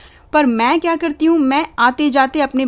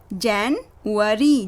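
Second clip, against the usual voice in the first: slow full word; 2 semitones lower, much slower than usual. Shown as a demonstration. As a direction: say January slowly and fully. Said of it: "break हो सकता है".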